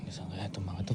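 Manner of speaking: hushed whisper so no one hears; whispering while hiding. Real, not acted. Sneaking up, repeats hushed.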